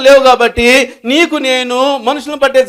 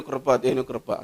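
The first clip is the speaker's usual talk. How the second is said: much softer and lower; hushed; 10 semitones lower, 13 dB quieter, slower than usual. Real, not acted. Preacher repeats God's grace to the congregation, hushed. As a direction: let it sink to a hush. Repeats phrase, fading to hush.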